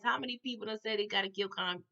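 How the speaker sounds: usual speaking voice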